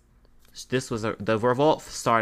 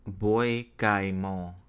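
French accent attempt; slower than usual. Shown as a demonstration. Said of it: "me trying to say it in my best French accent".

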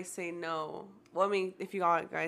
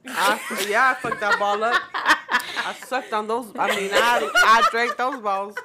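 laughing while talking; 2 semitones higher, 12 dB louder, slower than usual. Real, not acted. Laughing about drinking the balls.

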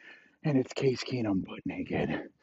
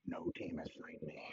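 whisper; 5 semitones lower, 20 dB quieter than usual. Acted. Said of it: "He's like".

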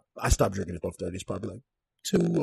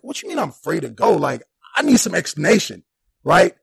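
higher, louder, outraged; indignant disbelief; 7 semitones higher, 8 dB louder than usual. Retold or imitated. Man demanding why he's free to go, outraged higher voice. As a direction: demand an explanation. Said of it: "That's going through my head".

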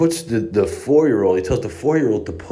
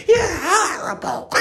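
put-on much higher, slow, whiny toddler sobbing at the guard; crying little girl. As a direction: wail like a cross four-year-old. Four-year-old scolding the guard.

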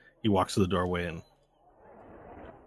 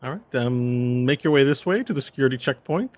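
a hint of a higher, cheerful usher; cheery escort; 5 semitones higher, 5 dB louder than usual. Escort directs guests to the security checkpoint, lightly cheery. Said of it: "the cheery voice of the escort".

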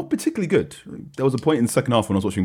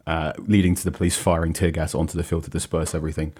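normal talking voice

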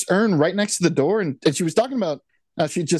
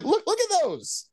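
mock excited pointing; 12 semitones higher, 4 dB quieter, slower than usual. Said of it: "I'm like".

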